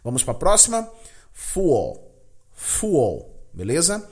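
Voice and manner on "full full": full with a dark final l; English full. Shown as a demonstration. Teacher drilling full for students. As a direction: say full with rounded vowel and dark l. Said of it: "nada de u".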